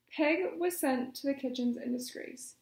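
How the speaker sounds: usual speaking voice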